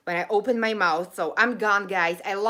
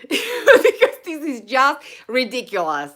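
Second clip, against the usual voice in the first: laughing in disbelief; 10 dB louder than usual. Real, not acted. Can't turn off the stream.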